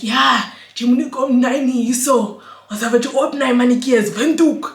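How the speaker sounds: loud menacing street voice; tough threat. Retold or imitated. To man hung over the edge, loud street threat. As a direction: threaten him from the balcony.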